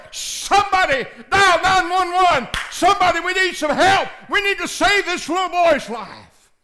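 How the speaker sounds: high, loud shouts at a crash scene; hollering for help. Retold or imitated. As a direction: yell for someone to call nine one one. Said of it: "you holler".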